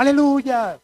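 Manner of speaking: high-pitched hallelujah cry, mildly loud; imitated shout. Woman in the congregation shouting as he preaches, imitated shout. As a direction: cry out like an excited churchgoer. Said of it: "ella gritaba".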